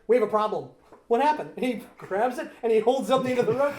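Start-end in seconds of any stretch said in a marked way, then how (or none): 0.1-1.5 high loud worried lines; loud alarmed dialogue. Retold or imitated. Acted film dialogue.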